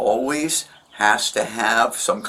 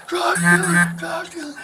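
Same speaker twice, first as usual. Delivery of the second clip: high, strained mock screaming; imitated screaming. Witness re-enacting his friend yelling at the neighbor, mock screaming. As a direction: mimic repeated screamed accusations, pitched far up without full volume. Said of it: "he was screaming as loud as a man could".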